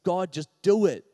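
loud, insistent plea; retold begging. Preacher retelling prayer on knees, loud begging. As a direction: beg God with a forceful burst. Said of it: "I begged and I pleaded".

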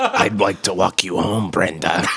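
creepy imitation. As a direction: imitate a creepy man's come-on, dropping low. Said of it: "some creepy ass shit".

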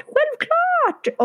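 "självklart" jumped up very high and falling; high emphatic echo. Retold or imitated. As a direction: repeat "självklart" squeaky high, overeager. Waitress repeats emphatically.

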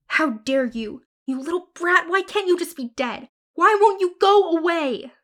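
hollering in fury. Acted. Sister screaming at Matilda over the dead cat. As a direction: hurl the words at her. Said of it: "as I hollered terrible words".

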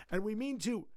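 words break into a croak. Acted. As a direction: let the voice crack to a croak. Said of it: "his voice turned into a croak".

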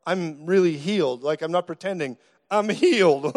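stunned insistence building to a loud cry; imitated meltdown. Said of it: "like, a meltdown almost over it".

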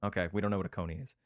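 ordinary talk

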